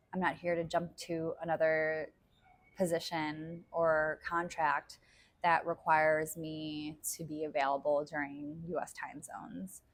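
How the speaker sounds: ordinary delivery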